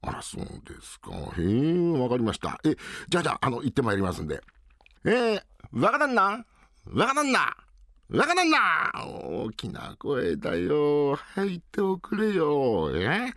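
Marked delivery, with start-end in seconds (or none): 5.1-8.9 put-on higher calls of young master, building up; calling out.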